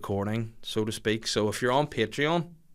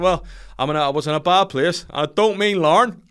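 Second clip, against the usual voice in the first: much higher and louder, hammed-up open-mic comedian; mock hack comic; 7 semitones higher, 9 dB louder than usual. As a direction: sell the tired joke like a bad local comic. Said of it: "you'll hear someone submit".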